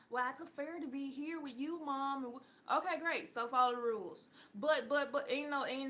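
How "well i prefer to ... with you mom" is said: son's reply. Retold or imitated. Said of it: "Which one do you prefer?".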